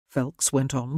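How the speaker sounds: plain reading voice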